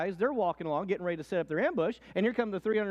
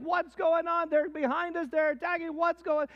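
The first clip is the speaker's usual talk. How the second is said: high, louder confused cries; hammed-up alarm, higher pitch; panicked soldiers; 10 semitones higher, 4 dB louder than usual. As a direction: soldiers lost in the chaos of an ambush. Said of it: "They run into each other like".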